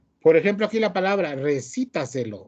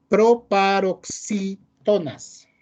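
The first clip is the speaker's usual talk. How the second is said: syllable by syllable; 5 semitones higher, much slower than usual. Real, not acted. Reading a long term carefully.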